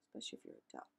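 whispered aside; a playful whispered aside to the viewer. Real, not acted. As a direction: drop to a whisper for the joke. Aside whispered while reading.

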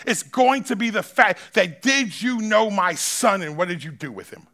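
loud, driving preacher's cry; preaching at full force. Acted. Preacher pressing the congregation to settle it, full-force preaching. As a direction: hammer each line home to the crowd.

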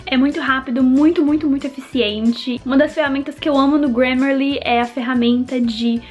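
upbeat sponsor read. Acted. Sponsor read before the vlog.

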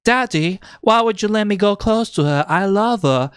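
retold child's plea, high-pitched; Elizabeth. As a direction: pitch it up like a pleading girl. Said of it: "Elizabeth, when she's, you know".

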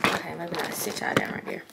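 breathy whispered aside. Real, not acted. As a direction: whisper it to yourself. Aside after retake.

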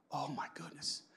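breathless hushed whisper; awed whisper. Pretend. Listener thinking to himself in awe, whispered.

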